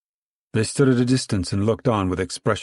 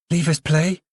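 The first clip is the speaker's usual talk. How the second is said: put-on high child's plea, raised up; crying out; 9 semitones higher than usual. A narrator's reading. Girl calls out to the croquet players, pleading high. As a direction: call out to join the game. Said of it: "Ruthie cried".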